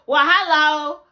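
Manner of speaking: loud, high-pitched outburst; amused exclamation. Real, not acted. Reacting to camera snubbing Nigerian accent, loud amused exclamation. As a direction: cry it out in mock dismay.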